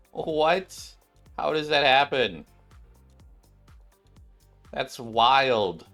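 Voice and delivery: ordinary talk, nothing marked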